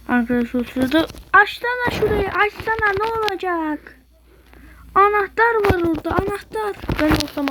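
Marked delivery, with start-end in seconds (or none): 0.6-4.0 higher, urgent pleading; excited urging. Real, not acted. Urging a player in game. 4.9-6.8 higher, eager pointing out; excited. Real, not acted.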